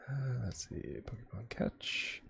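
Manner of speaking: whispered, slow, under the breath; whispering. Real, not acted. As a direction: read it out in a hushed whisper. Reading chat command under breath.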